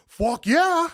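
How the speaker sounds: loud, high, eager yes; excited. Retold or imitated. Host imitating friend's instant yes to the show, loud and thrilled. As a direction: fire it back like an instant yes. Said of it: "you're like".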